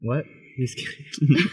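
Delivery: ordinary delivery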